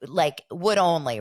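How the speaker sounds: ordinary talk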